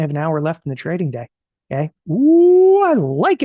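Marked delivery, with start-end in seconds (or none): 2.1-3.4 high, loud gleeful ooh; delighted ooh. Real, not acted. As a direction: squeal with delight at the trade. Delighted reaction to the trade.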